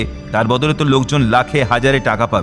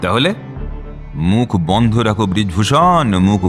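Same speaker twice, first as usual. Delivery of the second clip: menacing warning; 7 semitones lower than usual. Acted. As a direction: drop low and draw out the threat. Boss silencing his underling.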